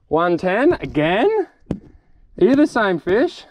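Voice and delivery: high, drawn-out incredulous questions; amazed disbelief. Real, not acted. Angler finding another one-ten fish, astonished. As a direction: question it slowly, pitch leaping up in amazement. Measures fish, same length again.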